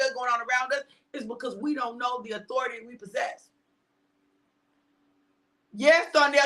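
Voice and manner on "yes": ordinary delivery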